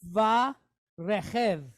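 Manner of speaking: Va Rechev said with a guttural ch in Rechev; Hebrew word demo. Shown as a demonstration. Teacher joins the syllables into a word, careful Hebrew pronunciation. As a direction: sound out Va Rechev slowly. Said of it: "So we have".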